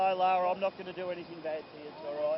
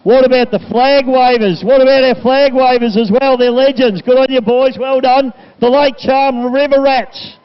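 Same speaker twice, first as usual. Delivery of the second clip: loud, high shout-out over the PA; rally the crowd to cheer the crew; full-force, raised race-day announcing; hype announcer; 8 semitones higher, 28 dB louder, slower than usual. Real, not acted.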